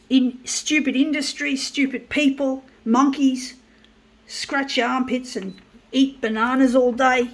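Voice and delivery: spitting contempt at the industry; angry insults. Real, not acted. Mocking copier workers as monkeys, loud angry yelling. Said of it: "I so get so angry".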